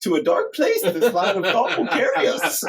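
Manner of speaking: Yoda voice. Shown as a demonstration. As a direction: redo it as Yoda, giggling through it. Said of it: "you need to do a Yoda voice".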